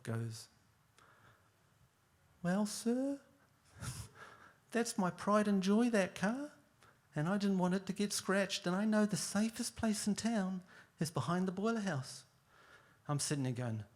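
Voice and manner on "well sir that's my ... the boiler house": high, overly polite witness voice; [name]'s put-on voice. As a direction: sweet-talk the court in a higher voice. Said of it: "Dude, what's with the voice?".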